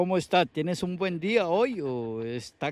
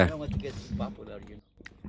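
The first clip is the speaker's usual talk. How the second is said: mumbled, fast; 5 semitones lower, 6 dB quieter than usual. Real, not acted. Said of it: "Say it?".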